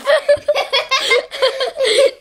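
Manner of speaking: high helpless cackling; high, uncontrolled laughing fit; laughing her head off. Real, not acted. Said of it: "That's [name] laughing her head off".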